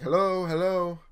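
a man calling from outside a closed store. Retold or imitated. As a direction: call through the locked door. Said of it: "some guy saying".

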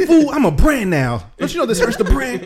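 high, haughty scolding; bossy wife voice. Retold or imitated. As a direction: scold him like a boss. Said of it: "she's like".

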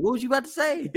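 high startled quote, voice jumping up. Retold or imitated. To his cohost, a startled high question. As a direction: act caught off guard by the question. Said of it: "I said".

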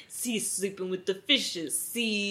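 soft-voiced old-movie gangster bit; mock gangster. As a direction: lightly imitate a gangster's quip. Quotes the line, gangster cliché.

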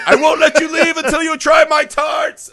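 high, loud, hammed-up declamation; Heston impression. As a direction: belt it out as a melodramatic movie-star parody. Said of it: "Like, in her Best Heston voice".

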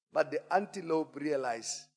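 ordinary delivery

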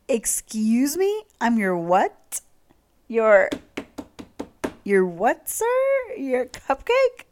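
loud, slow incredulous "Excuse me? Your cupcake?"; shocked disbelief. Real, not acted. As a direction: stretch out each question in stunned disbelief. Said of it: "shocked reaction".

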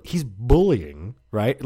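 ordinary talk

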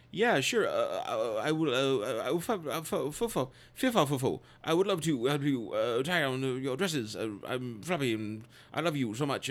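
put-on stammering posh British accent, f-f-f stutter; mock-bashful British stammer. Charles fumbling yes to Carrie, stammering British accent. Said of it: "That, that British accent is spectacular".

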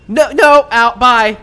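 loud, high, hurried shooing. Acted. Gemini shoos the spider man out, loud. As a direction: push him out the door fast. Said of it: "does, like, a double take and goes".

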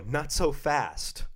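slow, drawn-out warning to stop; halting someone. Retold or imitated. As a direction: stretch each word to stop them in their tracks. Storyteller halting a line-cutter.

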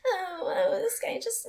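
high, small helpless whimper, hammed up; put-on damsel-in-distress voice; mock victim. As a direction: play the poor victim in a high, soft voice. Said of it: "instead of me being like this".

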